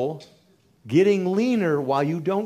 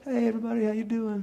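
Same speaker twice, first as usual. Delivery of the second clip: higher, softer, lightly cheery treadmill chatter; mock breezy gym-goer; 7 semitones higher, 3 dB quieter, faster than usual. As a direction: mimic someone coasting on a treadmill. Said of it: "on a treadmill going".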